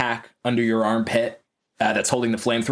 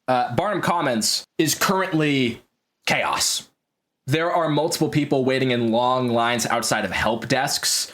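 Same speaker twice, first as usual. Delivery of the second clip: ordinary delivery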